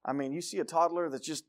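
normal talking voice

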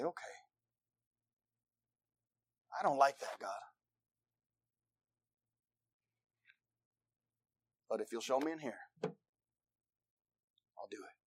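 very soft, halting assent; submissive. Acted. Preacher voices believer yielding to God, submissive. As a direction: give in quietly, with long pauses. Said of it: "You'll be submitted to what God says".

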